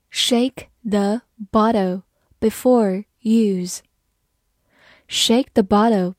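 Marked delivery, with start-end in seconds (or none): 0.1-3.8 slow read. Shown as a demonstration. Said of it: "好，慢慢来读".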